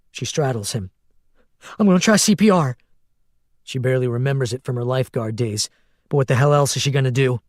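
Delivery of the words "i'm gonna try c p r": tense, determined. A narrator's reading. Starting CPR in panic.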